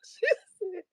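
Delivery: crying-laughing retelling; laughing while talking. Real, not acted. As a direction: push words through tears of laughter.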